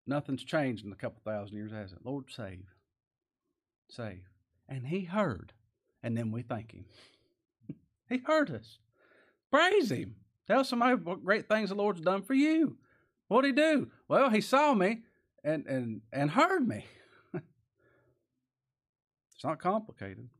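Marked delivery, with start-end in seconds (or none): 2.0-4.3 soft, lower, hushed save; quiet plea. Retold or imitated. Preacher softly echoing David's plea, a hushed prayer. 8.1-16.7 high, loud exhortation; preaching at full force. Acted. Preacher urging the congregation to praise, full-force preaching.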